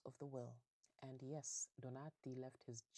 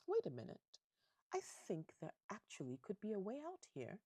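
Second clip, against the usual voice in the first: higher-pitched dawning realization; sudden idea; 7 semitones higher than usual. Acted. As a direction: act out a scheme occurring to you. Schicchi suddenly gets an idea.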